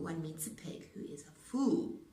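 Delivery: ordinary delivery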